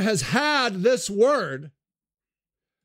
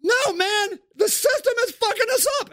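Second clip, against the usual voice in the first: angry yell; 11 semitones higher than usual. Acted. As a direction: blame the system at full voice. Sage yells.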